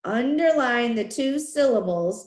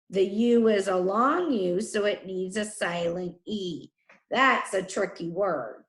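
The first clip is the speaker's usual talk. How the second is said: ordinary talk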